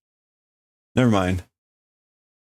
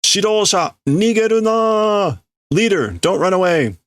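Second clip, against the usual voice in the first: angry shout; 10 semitones higher than usual. Acted. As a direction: shout after the fleeing leader. Workers order the leader not to flee.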